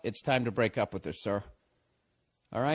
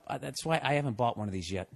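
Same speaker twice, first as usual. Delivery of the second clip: ordinary delivery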